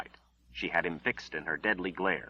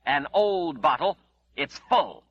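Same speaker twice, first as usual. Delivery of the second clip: sharp, raised voice, higher and louder; razor-edged; 7 semitones higher, 6 dB louder, slower than usual. A narrator's reading. Mother confronting her lying son, sharp and raised. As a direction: cut him down with a razor edge. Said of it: "Her razor-edged voice was back".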